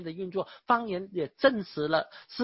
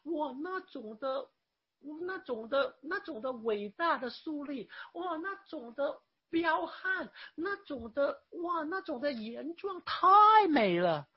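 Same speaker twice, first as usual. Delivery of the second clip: gushing awe; 5 semitones higher than usual. Pretend. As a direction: gush like a tourist overwhelmed by beauty. Mocking someone raving about Petra. (夸张地赞叹)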